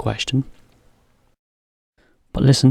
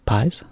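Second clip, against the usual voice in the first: Pies rising as a puzzled echo; teacher echoing one word as a question. Shown as a demonstration. Echo question, Pies rising. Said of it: "you can just say".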